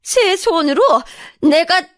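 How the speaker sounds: high-pitched, indignant complaint; outraged accusation. Acted. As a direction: build to a quick, loud finish. Mother-in-law accuses her before family.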